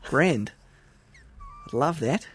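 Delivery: lively put-on Aussie accent; Australian accent. Pitching an Australian-accent episode to co-host, mock Australian accent. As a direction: banter in an exaggerated Australian twang. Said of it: "Should we do an episode in Australian accent?".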